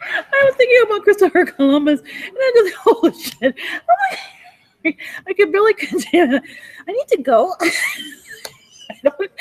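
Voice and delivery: high, laughing so hard it nearly cracks; can barely get the words out for laughing; laughing helplessly. Real, not acted. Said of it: "I'm laughing my ass off".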